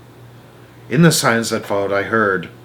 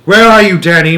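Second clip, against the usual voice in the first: loud, high call; father calling to find his son; 7 semitones higher, 9 dB louder than usual. A narrator's reading. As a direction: call to your son through the trees. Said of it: "my father called out".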